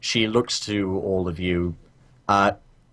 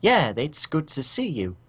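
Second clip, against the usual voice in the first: higher, lightly voiced small noblewoman with a Cockney lilt; a reluctant polite greeting in a higher voice; lady NPC voice; 7 semitones higher than usual.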